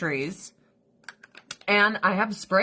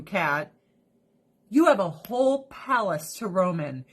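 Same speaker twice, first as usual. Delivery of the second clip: ordinary delivery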